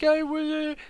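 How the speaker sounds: very high mock crying voice; imitated bawling. Beaten old man trying to explain, imitated bawling. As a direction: squeal it out like someone sobbing mid-explanation. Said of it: "still bawling his eyes out".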